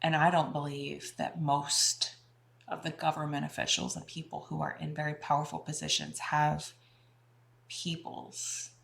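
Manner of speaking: soft, restrained and sorrowful; grave, heartfelt. Real, not acted. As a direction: speak quietly, pausing under the weight of it. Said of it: "I have a lot of feelings as a mother".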